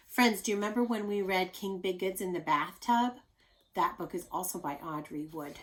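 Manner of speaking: usual speaking voice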